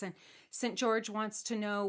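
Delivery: plain reading voice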